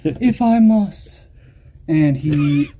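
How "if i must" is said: high, slow, put-upon "if I must"; reluctant sigh. Retold or imitated. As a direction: drag it out like a weary martyr. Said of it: "Yeah, he just".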